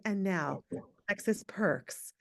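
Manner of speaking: hint of a smooth radio host introducing a segment; radio announcer voice. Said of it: "She has a radio style voice".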